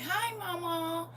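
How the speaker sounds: put-on high, soft, drawn-out child voice; little child. Grandchild greeting grandma on the phone, imitated child voice. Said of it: "She'll say".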